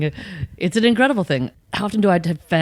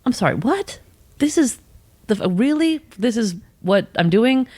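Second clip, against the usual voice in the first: higher, baffled double take; retold disbelief, pitched up; mock disbelief; 5 semitones higher than usual. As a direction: act stunned at your own life. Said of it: "I think".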